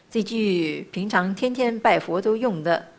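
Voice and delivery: ordinary talk, nothing marked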